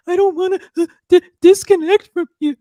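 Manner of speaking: high, loud, tearful plea; imitating hysterical crying. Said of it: "he was hysterical".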